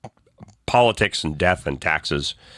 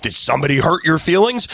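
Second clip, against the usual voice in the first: mock TV attorney; 8 semitones higher than usual. Mocking TV lawyers' pitch.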